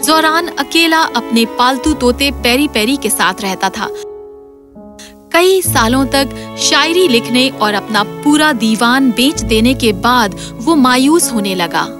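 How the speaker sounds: plain reading voice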